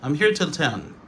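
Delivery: "till" as a single syllable; casual "till", run into the sentence; reduced form. Shown as a demonstration. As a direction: shorten until to "till". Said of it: "c'est la réduction de until".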